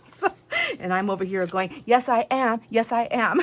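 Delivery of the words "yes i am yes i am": loud, high, proud self-affirmation; gleefully unashamed. Retold or imitated. Shopper owning up to onlookers' shocked looks, gleeful. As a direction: declare it boldly, about to laugh.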